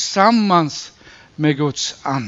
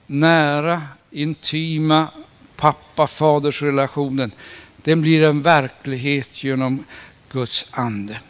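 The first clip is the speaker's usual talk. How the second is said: ordinary delivery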